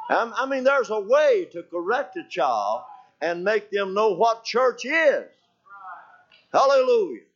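lifted sermon cadence, last phrase stretched out; emphatic preaching. Real, not acted. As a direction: drive the moral home to the congregation. Preacher drives the moral home.

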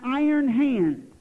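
"iron hand" slow, each syllable rigid; drawn-out, stiff, every sound marked; over enunciating. Shown as a demonstration. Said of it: "enunciate every syllable so rigidly".

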